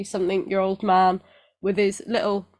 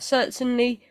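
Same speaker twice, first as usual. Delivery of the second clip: put-on New Zealand accent; 3 semitones higher than usual. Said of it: "Don't know why I did a New Zealand accent then".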